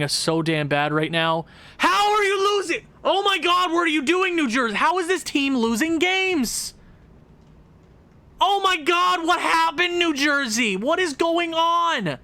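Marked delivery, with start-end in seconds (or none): 1.8-6.7 fed-up fan yelling at New Jersey in disbelief; exasperated yell. Real, not acted. 8.4-12.2 fed-up fan yelling at New Jersey in disbelief; exasperated yell. Real, not acted.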